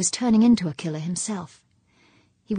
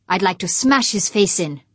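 loud, menacing growl, louder than usual; growled threat; 7 dB louder than usual. Acted. Fuchs telling his wife what he'd do to Humphreys, loud growling threat. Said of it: "Fuchs growled".

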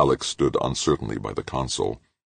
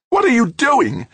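much higher, louder terrified shriek; 8 dB louder than usual. A narrator's reading. Halleck shrieking at Grant as he smashes the phone. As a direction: scream it in panic. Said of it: "Halleck shrieked".

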